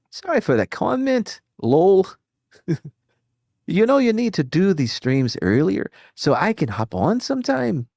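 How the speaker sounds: high-pitched put-on Northern Irish accent reading a donor message. Streamer reads a donor's comment in Northern Irish accent. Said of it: "I nailed that Irish accent".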